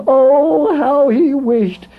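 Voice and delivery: high, drawn-out sigh of longing; longing wish. A narrator's reading. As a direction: ache for it, stretching the words. Narrator voices Jack's longing.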